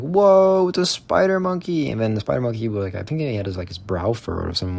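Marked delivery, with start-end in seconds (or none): 0.0-1.9 high, loud, gawking "Whoa"; playfully mocking gawking tourists; mock wowed visitor. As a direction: imitate an amazed zoo crowd. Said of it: "being like".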